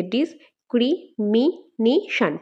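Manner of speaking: ডিসক্রিমিনেশন slowly, five separate beats; split into syllables. Shown as a demonstration. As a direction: count out ডিস, ক্রি, মি, নে, শন in ডিসক্রিমিনেশন. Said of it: "পাঁচটা হচ্ছে". (ডিসক্রিমিনেশন পাঁচ ভাগে আলাদা করে)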